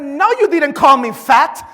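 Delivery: loud, offended protest; indignant retort. Retold or imitated. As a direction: fire it back, insulted. Indignant reply to cashier.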